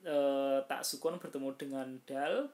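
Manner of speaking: ordinary talk, nothing marked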